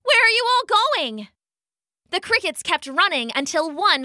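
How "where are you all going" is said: high, fast alarmed call; pitched-up, hurried shouted question; shouting. A narrator's reading. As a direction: call urgently after a fleeing crowd. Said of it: "the little bunny shouted".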